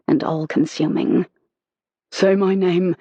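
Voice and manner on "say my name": usual speaking voice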